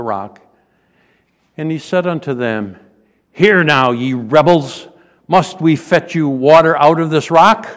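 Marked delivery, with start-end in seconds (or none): 3.3-7.7 loud, scolding call to the crowd; angry rebuke. Acted. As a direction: rebuke the rebels from the rock. Moses rebukes the congregation.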